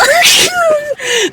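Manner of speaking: long high-pitched squeal of laughter; high, drawn-out gleeful squeal; delighted squeal-laugh. Real, not acted. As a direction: shriek with glee at the thought. Delighted by own wild theory.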